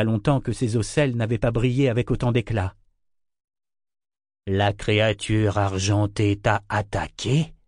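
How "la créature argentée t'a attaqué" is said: plain reading voice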